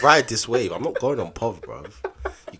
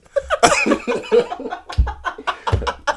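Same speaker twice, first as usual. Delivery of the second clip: loud high laugh; 6 dB louder than usual. Real, not acted. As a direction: burst of high-pitched laughter. Loud laugh after bragging.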